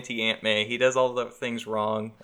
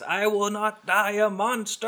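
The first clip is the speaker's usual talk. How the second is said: high, slow villain voice; a high theatrical Goblin declaration; Green Goblin quote; 10 semitones higher than usual. Retold or imitated. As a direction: intone it like a doomed supervillain. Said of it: "he goes".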